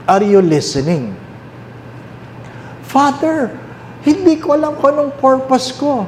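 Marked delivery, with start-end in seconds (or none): 2.8-6.0 high, pleading parishioner asking the priest; voiced confused parishioner. Parishioner asking the priest, high and pleading. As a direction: play the lost churchgoer asking Father for help.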